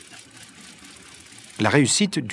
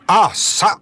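higher, louder sudden interjection; abrupt exclamation; 7 semitones higher, 6 dB louder than usual. A narrator's reading. Nicole interrupts his companions with a question, abrupt exclamation. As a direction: break in with a sudden thought.

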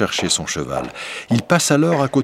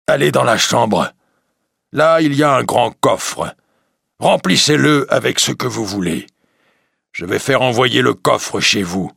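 higher, louder monster voice; beast voice; 5 semitones higher, 4 dB louder than usual. Acted. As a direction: correct him with menace like a beast. Beast speaks to merchant. (la bête reprend le marchand, voix forte et aiguë)

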